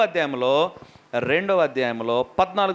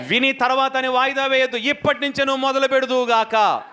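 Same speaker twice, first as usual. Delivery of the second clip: rousing sermon exhortation; fervent preacher's call; preaching; 4 semitones higher than usual. Acted. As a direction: urge the church to begin the work. Preaching to the congregation.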